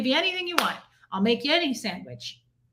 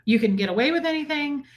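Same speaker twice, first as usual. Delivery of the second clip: ordinary talk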